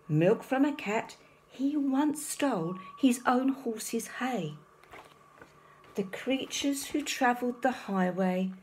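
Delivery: plain reading voice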